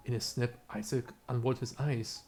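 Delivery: ordinary talk, nothing marked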